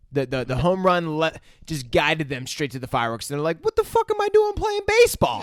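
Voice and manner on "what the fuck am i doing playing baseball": put-on high, loud stunned kid voice; lightly hammed dazed kid. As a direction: squeak it out like a dazzled kid. Kids awed by fireworks, voiced.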